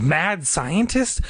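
slow, high questioning echo; drawn-out, rising alarm; mounting thought. A narrator's reading. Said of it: "The words kept repeating in her mind".

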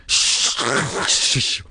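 long hissed shush, twice; insistent shushing. A narrator's reading. Casey shushing the girls, insistent. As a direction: insist on silence with a hiss. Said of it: "Casey insisted".

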